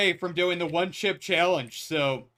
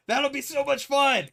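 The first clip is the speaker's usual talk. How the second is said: excited; 8 semitones higher than usual. Real, not acted. Hyped about Friday stream.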